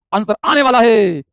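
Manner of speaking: slow, swelling announcement; drawn-out anticipation. Real, not acted. Teacher waiting for students' answers, drawn-out suspenseful call. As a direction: stretch it out to build suspense. Teasing while awaiting answers.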